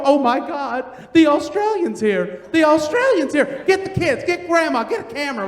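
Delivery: high, gushing acted excitement; excited fan. Lady at the door calling her family, thrilled. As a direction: call the household over in a starstruck fluster.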